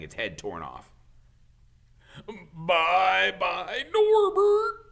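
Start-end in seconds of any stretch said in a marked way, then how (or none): 2.5-4.8 very high, loud wailing goodbye; sobbing farewell. Acted. Hagrid bids baby dragon farewell, sobbing high. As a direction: sob it out. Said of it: "Hagrid sobbed".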